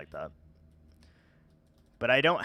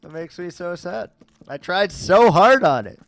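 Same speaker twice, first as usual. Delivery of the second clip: higher, louder hammed-up hurt whine; mock sad whine; 6 semitones higher, 13 dB louder than usual. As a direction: play up the wounded pride, pitched up and loud. Chat rated accent one of ten.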